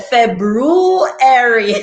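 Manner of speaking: FEBRUARY as "feb-ROO-airy", ROO stretched and swooping up; pronounced R. Retold or imitated. Imitating a school principal's February, R pronounced. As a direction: stretch the middle of "February" up high, then come down. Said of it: "we had to say it like her".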